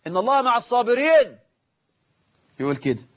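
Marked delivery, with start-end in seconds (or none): 0.1-1.4 calling out to imam. Acted. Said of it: "البدعة الأولى، بعضهم يصفق".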